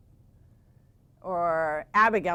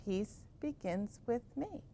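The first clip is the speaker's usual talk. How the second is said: much softer, slow; soft mantra; 2 semitones higher, 10 dB quieter, slower than usual. Shown as a demonstration. Teacher showing how to calm down at school, softly. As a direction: say it softly to yourself. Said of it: "you could simply do".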